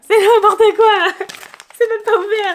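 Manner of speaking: loud, high laughing disbelief. Real, not acted. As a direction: laugh at your own clumsiness. Laughing at a torn parcel.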